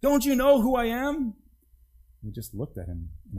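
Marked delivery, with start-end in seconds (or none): 0.0-1.3 high, loud, indignant demand; offended donkey shouting at the villagers; cross donkey. Acted. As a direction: bark the order at the townsfolk. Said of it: "he said crossly".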